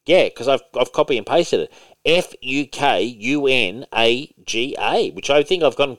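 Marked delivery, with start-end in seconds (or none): 2.0-5.1 slow letter-by-letter spelling. Shown as a demonstration. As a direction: give each letter its own space. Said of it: "Did you spell that right?".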